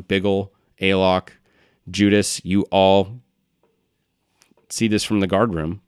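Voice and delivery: usual speaking voice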